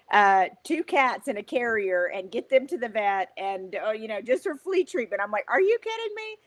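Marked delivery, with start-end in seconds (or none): 5.5-6.4 high-pitched, incredulous retort; exasperated and much higher; short, borderline rude. Retold or imitated. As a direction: retell it as a curt, high protest. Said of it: "I was kinda short, maybe borderline rude".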